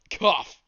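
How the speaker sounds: high, loud spoken "cough"; loud fake cough. Ghost faking a cough mid-prophecy, loud. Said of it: "spice stuck in my throat".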